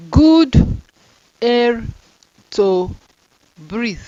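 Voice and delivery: each word punched out separately; slow dictation, good punched loud and high; word-by-word reading. Shown as a demonstration.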